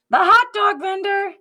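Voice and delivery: high, loud excited announcement; spring the surprise on the kids; big storybook reveal. Acted. Reveal of the visitor.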